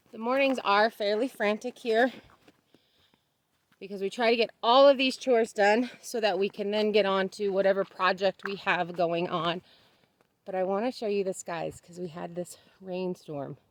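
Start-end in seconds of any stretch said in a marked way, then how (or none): none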